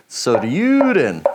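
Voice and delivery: Soryuden drawn out slow, each syllable stretched; slightly Swedish-sounding Soryuden; odd Japanese accent. Shown as a demonstration. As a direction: sound out Soryuden carefully, like practising a new word. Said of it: "such an odd accent".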